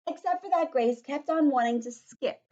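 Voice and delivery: loud, animated read-aloud; projected, lively storytelling voice; story reading to children. A narrator's reading. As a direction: read it out big for a class of children.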